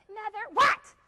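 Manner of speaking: a daughter snapping at her mother; bratty girl. Acted. Girl answers back in scene.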